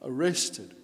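normal talking voice

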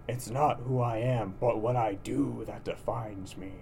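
slow, lightly gruff hero line; a weak attempt at Batman, slowed down. Shown as a demonstration. Quoting Batman to co-host, slow mild growl. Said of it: "You don't have as good of a Batman voice".

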